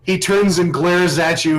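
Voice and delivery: normal talking voice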